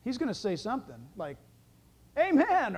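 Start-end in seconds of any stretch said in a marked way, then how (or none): 2.2-2.8 lightly voiced archangel, higher pitch; archangel's voice. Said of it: "a voice of an archangel".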